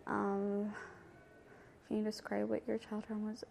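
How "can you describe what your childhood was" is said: much softer, thinking aloud; musing to oneself. Real, not acted. Interviewee repeating the childhood question to herself, musing quietly. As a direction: repeat it under your breath.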